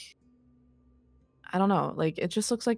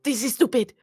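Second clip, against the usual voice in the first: loud high exasperated shout; frustrated yell; 9 semitones higher, 6 dB louder than usual. Acted. Marina yelling at the bickering pair. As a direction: explode at both of them. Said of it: "Marina goes like".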